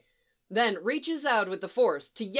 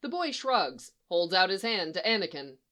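usual speaking voice

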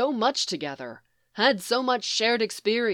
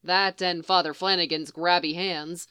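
plain reading voice

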